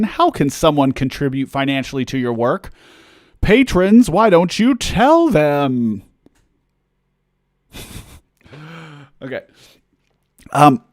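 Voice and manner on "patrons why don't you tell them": high, loud playful call, last words drawn out. Pretend. Streamer hands money question to patrons, loud hammed-up call. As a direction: sing it out big to a crowd, stretching the end.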